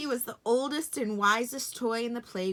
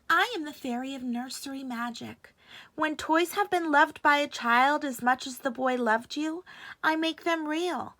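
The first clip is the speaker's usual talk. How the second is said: higher fairy voice; fairy of magic; 6 semitones higher, 4 dB louder than usual. A narrator's reading. Fairy explaining her magic to the rabbit, high.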